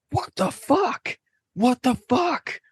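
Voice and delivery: very high, drawn-out squeal; man from a gif. Retold or imitated. Imitating a TV guy from a gif, high-pitched disbelief. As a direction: squeak it slowly in mock disbelief. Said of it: "it's a guy going".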